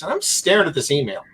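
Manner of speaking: normal talking voice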